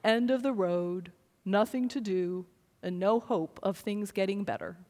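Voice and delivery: a parent's favorite gloomy Eeyore quote. Retold or imitated. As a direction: drone it with total defeat. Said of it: "my absolute favorite one is".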